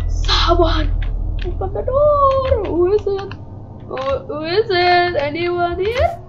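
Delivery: high anxious calls; scared calling out. Real, not acted. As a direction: call out nervously.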